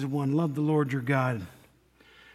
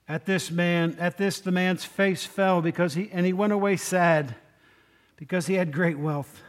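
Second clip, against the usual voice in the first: normal talking voice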